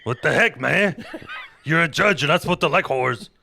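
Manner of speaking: loud, high comic Bible retelling; boisterous mock-epic narration; hammed-up storytelling. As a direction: tell it like a rowdy campfire tale.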